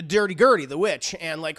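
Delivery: normal talking voice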